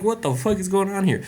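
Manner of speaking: retold bewilderment. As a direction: exclaim it in mock disbelief. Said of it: "And I'm just like".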